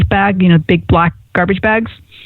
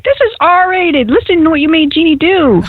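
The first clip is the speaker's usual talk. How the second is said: Casey; 8 semitones higher than usual. Pretend. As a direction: stretch it out like a playful telling-off. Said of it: "Casey's gonna be like".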